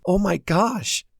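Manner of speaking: stunned gasp of disbelief; gasping surprise. A narrator's reading. Girlfriend learning he left right after her, gasping surprise. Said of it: "Mary gasped".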